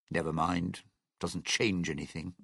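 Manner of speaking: low, softened brush-off; subdued dismissal. Acted. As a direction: drop the pitch and let the subject go quietly. Catches himself, drops the subject.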